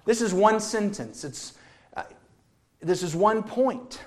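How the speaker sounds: ordinary talk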